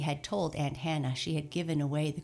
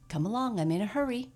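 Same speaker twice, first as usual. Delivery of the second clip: hurried; 7 semitones higher, 5 dB louder, faster than usual. A narrator's reading. Santa urging scarecrow.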